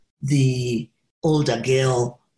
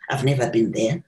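usual speaking voice

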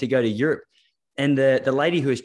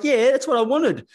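much higher, slower smug put-on voice; tough woman impression; 12 semitones higher, 3 dB louder than usual. Host imitates the tough athlete's smug reply. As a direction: drawl it high and smug. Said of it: "She's like".

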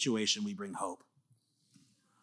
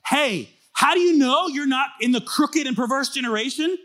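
much higher, louder, rising mock question; Paul's challenge; 13 semitones higher, 7 dB louder than usual. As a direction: throw down the gauntlet like Paul. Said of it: "Here's what he just said".

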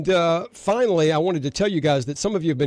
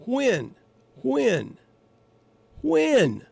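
each "When?" pitched higher and higher; worked-up repeated question; 8 semitones higher, much slower than usual. Real, not acted. Host demanding answers from listeners, increasingly worked up. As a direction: hammer the question home, climbing. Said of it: "I'm getting a little worked up here".

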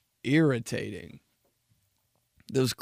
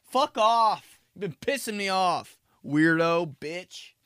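angry outburst; 5 semitones higher, slower than usual. Retold or imitated. Yelling at lobby people.

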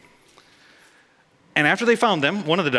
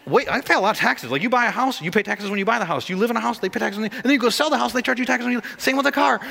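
mock complaint; 8 semitones higher than usual. Pastor complaining about taxes.

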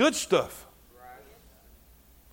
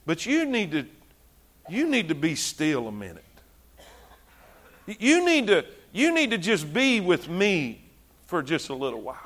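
God speaking gently; 5 semitones lower, 7 dB quieter than usual. Retold or imitated. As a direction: speak as a calm God telling him to stop. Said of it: "And God says".

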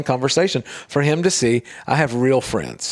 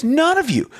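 high-pitched stand-up delivery, imitated; retold comedian; 14 semitones higher than usual. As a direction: retell it like a comedian on stage, pitched up. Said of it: "he's a comedian".

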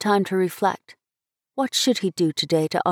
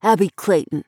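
indignant scolding by name; angry outburst. A narrator's reading. Brother confronting his meddling sister, angry. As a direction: call her out crossly. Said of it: "he broke out".

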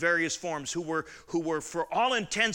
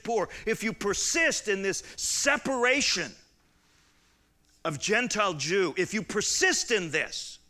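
higher, louder preacher's build; preaching at full force; 5 semitones higher, 3 dB louder than usual. Acted. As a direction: hammer each "if you persist" rising.

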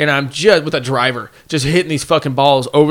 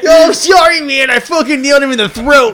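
very high, loud hammed-up cry; mock shouted apology; 12 semitones higher, 6 dB louder than usual. Golfer to the man hit in the throat, mock shouted apology. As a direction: yell a fake sorry across the fairway.

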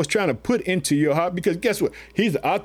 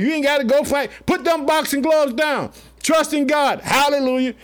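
higher, building preacher's cry; rising exhortation; 6 semitones higher than usual. Real, not acted. Preacher telling the church to put the gloves down, exhorting high. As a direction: climb and exhort the flock.

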